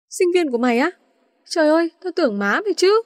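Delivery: mildly astonished, rising question; surprised. A narrator's reading. An learns they are his friend's students, surprised. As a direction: react in teasing disbelief. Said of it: "Ngạc nhiên An nói".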